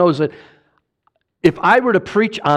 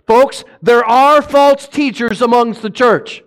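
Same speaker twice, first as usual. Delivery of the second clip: preaching emphasis; 6 semitones higher, 4 dB louder than usual. Acted. Preaching peak, slowed.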